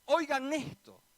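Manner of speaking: a loud cry of "listen to this"; emphatic call. Pretend. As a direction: call out to grab their attention. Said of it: "como queriendo decir".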